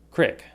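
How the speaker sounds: crick with a short i, like "krik". Shown as a demonstration. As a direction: pronounce crick with a short clipped i. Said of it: "I say".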